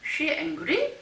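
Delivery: angry lifted at the end with no verb; rising question tune. Shown as a demonstration. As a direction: make it a question just by raising angry. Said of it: "어떻게 생략이 되느냐".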